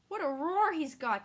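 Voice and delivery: loud, high cry of alarm; startled exclamation. Acted. Dandelion reacting to the djinn's roar, alarmed loud cry.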